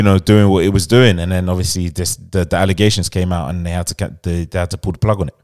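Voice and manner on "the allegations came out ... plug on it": ordinary talk, nothing marked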